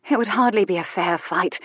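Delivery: plain reading voice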